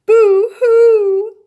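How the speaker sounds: high wailing boo-hoo; loud crying. A narrator's reading. Little beaver alone by the pond, wailing. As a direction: wail it out like a sobbing child. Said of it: "he said".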